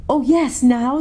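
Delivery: high, eager exclamation; excited child's eager reply; delighted girl. A narrator's reading. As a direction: burst out high, full of glee. Lucy jumps up clapping her hands.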